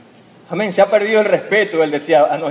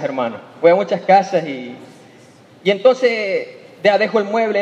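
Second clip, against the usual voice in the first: usual speaking voice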